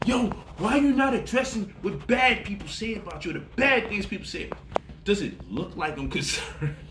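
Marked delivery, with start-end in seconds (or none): 0.1-4.5 higher, a hint of nagging critics; lightly voiced pestering critic; mock whiny critics asking why he won't respond; mimicking critics. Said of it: "I know people want me".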